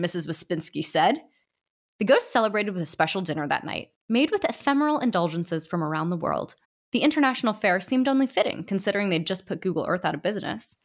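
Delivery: plain reading voice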